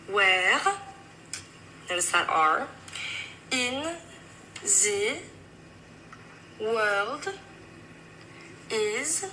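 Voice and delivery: French-accented English, word by word; slow, deliberate French accent, pausing between words. Shown as a demonstration. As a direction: put on a French accent, one word at a time.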